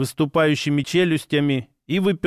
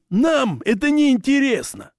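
barking orc; 10 semitones higher than usual. Acted. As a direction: snap it at them like an orc. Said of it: "рявкнул орк".

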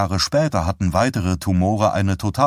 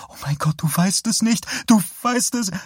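sobbing; 13 semitones higher than usual. Acted. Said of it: "in seltsam schluchzende Laute über".

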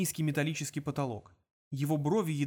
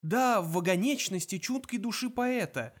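getting into stride; 6 semitones higher than usual. A narrator's reading. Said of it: "оседлал своего конька".

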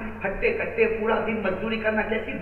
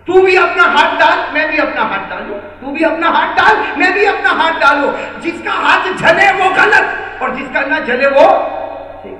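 loud, high taunting dare that slows at the end; loud challenge; 7 semitones higher, 11 dB louder than usual. Acted. Fire worshipper daring the saint to the fire test, loud taunting challenge. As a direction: throw down the dare with a laugh in the voice.

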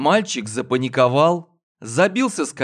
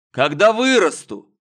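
sulky grumble; 6 semitones higher, 5 dB louder than usual. Acted. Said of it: "обиженно буркнул Варро".

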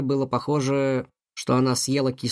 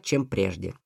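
ordinary delivery

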